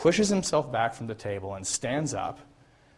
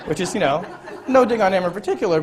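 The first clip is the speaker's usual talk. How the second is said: high, louder words with a laugh through them; laughing aside; 8 semitones higher, 10 dB louder than usual. Real, not acted. Joking that Bush said "the Google", laughing. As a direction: chuckle it off as you say it. Chuckling at Bush's Google gaffe.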